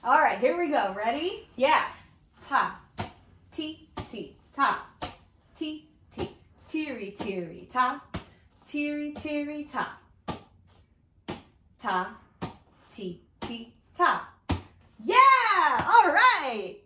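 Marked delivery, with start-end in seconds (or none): 2.4-14.3 rhythm syllables chanted evenly; steady beat. Shown as a demonstration. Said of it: "keep the steady beat". 15.0-16.8 high, loud whoop of triumph; excited cheer. Acted. Cheering at end of game.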